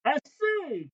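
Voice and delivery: high furious cry. A narrator's reading. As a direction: yelp it out in fury. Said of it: "criait le capitaine furieux".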